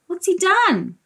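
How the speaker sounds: ordinary delivery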